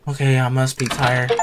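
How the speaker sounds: normal talking voice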